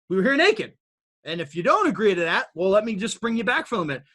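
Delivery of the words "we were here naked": higher, louder repeat hitting naked; rising emphasis. Real, not acted. Speaker repeats that humans began naked, higher and louder. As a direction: hammer the point home with naked punched. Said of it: "and you can agree".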